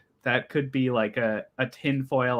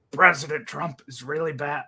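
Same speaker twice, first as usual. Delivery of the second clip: mock Bernie drifting into Alex Jones; a hint of a ranting pundit; lightly voiced political impression; 3 semitones higher than usual. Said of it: "I'm doing the Alex Jones voice".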